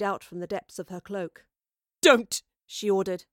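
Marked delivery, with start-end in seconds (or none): 2.0-2.4 loud, furious command; furious order. A narrator's reading. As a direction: cut them off in sudden fury. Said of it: "now a cauldron of fury".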